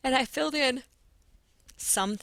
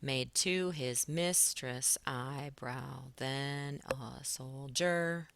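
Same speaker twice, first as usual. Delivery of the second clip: very slow, beat by beat on "Made to his mistress eyebrow"; slow scansion to count the beats; stressed scan; much slower than usual. Shown as a demonstration. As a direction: scan "then a soldier" slowly, pushing each stress. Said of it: "One, two, three, four, five again".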